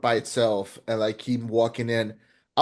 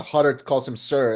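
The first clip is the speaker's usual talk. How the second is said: ordinary talk, nothing marked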